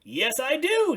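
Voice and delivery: high, loud excited Big Bird; Big Bird praising the painting. Acted. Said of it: "said Big Bird".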